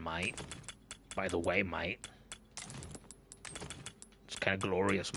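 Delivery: light put-on Australian accent, mate on every line; playful mock Aussie streamer voice. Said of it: "I'm stuck with an Australian accent".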